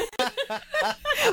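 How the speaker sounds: a high, loud burst of laughter in the wife's voice. Retold or imitated. The wife laughs off her husband's tall tale with a loud, high burst of laughter.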